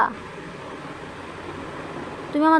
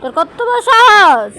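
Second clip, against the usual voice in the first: higher, louder, slow stern rebuke; mock scolding; 4 semitones higher, 8 dB louder, slower than usual. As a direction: scold each word slowly. Mother scolds child.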